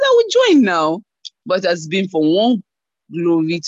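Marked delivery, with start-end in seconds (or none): 0.0-1.0 high-pitched mock protest; exasperated giving in. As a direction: raise the pitch sharply, as if finally giving in. Giving in to repeated urging.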